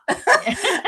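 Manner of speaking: sudden squeaky laugh breaking out; high burst of laughter. Real, not acted. To the host, laughing at her own bad accent. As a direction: laugh out loud at yourself.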